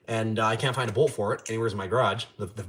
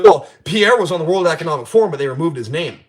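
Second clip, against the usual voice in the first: louder, smug objection; mock know-it-all; 6 semitones higher, 10 dB louder than usual. Imitating a Trump supporter arguing about Pierre, much louder and smug. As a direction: throw their argument back in a smug voice.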